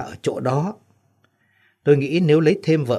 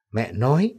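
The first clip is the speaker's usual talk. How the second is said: usual speaking voice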